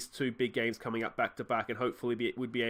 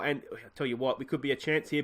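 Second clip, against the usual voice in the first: ordinary delivery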